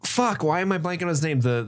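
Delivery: usual speaking voice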